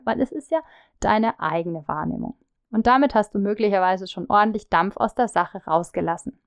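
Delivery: usual speaking voice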